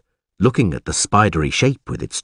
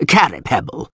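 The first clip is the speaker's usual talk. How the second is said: the name spoken at talking volume, slightly raised pitch; alderman addressing a subordinate; 4 semitones higher than usual. Acted. Alderman to the guard who has entered, matter-of-fact. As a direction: acknowledge the man who just came in. Guard now stands before him.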